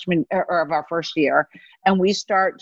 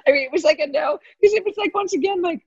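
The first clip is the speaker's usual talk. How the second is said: laughing; 13 semitones higher than usual. Real, not acted. Laughing retelling, pitch up far.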